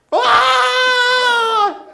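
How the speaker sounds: very high, loud shriek; startled scream. Retold or imitated. Wife surprised by screaming husband, startled scream. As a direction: imitate a wife's startled shriek. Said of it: "Why are you screaming at me".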